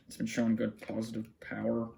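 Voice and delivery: usual speaking voice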